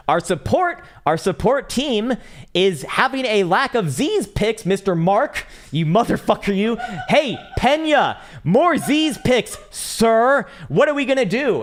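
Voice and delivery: high, loud, slow hammed-up tirade; mock roasting yell. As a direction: roast the support guy, laughing. Roasting support agent Mark.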